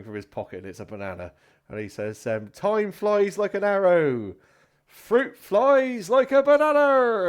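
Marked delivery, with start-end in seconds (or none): 2.5-7.3 loud, high, slowly stretched delivery; showman's punchline. Acted. Comedian's last joke with a banana prop, grandly projected. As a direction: proclaim the gag to the whole pub.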